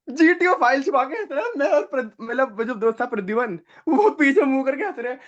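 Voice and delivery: high voice breaking with laughter; laughing while telling. Real, not acted. Retelling the candidate's mishap, voice high with laughter. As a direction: tell it while barely holding in laughs. Recalling the funny scene.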